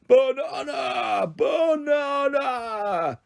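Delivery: high, long-drawn roar; hungry gorilla calling out; giant gorilla roar. A narrator's reading. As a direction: stretch each vowel into a slow animal bellow. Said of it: "heard the unmistakable roar of a giant gorilla".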